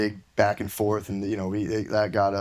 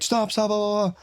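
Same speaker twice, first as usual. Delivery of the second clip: put-on pleading kid; 10 semitones higher than usual. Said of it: "the kid I knew was like".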